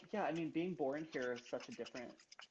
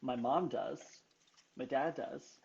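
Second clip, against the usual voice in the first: ordinary delivery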